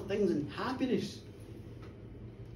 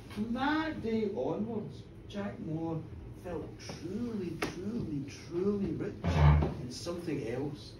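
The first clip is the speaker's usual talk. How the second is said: soft, low, slow closing words; tender ending; 7 semitones lower, 4 dB quieter than usual. A narrator's reading. Narrator closes the tale, soft and tender. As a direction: bring it gently to rest.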